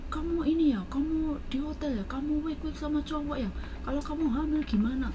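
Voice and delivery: high, accusing boss voice; imitating the boss's accusation, high; mock accusing boss.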